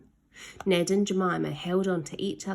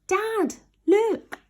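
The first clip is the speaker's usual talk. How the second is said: high, loud excited shout; calling down; 14 semitones higher, 5 dB louder than usual. Acted. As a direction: yell down to catch dad's eye. Calling to father's boat below.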